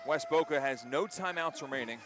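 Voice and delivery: normal talking voice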